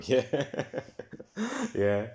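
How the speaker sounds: ordinary talk, nothing marked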